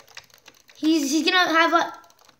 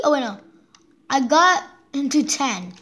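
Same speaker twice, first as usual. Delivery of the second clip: ordinary talk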